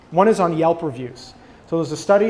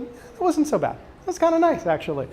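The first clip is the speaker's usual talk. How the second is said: much higher, dreamy, pleased patient voice; contented patient; 11 semitones higher than usual. Retold or imitated. Patient after soothing music tells the survey, dreamy and pleased. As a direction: float it out like a soothed, happy patient.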